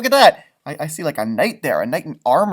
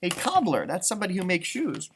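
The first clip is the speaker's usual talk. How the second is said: ordinary delivery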